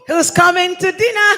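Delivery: loud, high call to everyone; yelling across the room. Real, not acted. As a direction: shout it out to the whole hall. Calling everyone to the meal.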